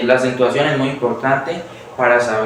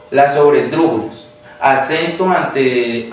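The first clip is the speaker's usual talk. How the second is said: usual speaking voice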